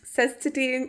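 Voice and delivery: normal talking voice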